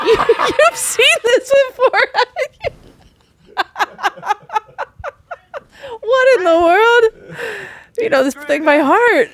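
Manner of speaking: high, squealing laughter through the words; laughing hard. Real, not acted. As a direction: crack up helplessly while talking. Cracks up at friend reciting clip.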